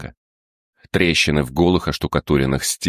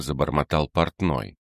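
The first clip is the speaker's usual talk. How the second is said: ordinary delivery, nothing marked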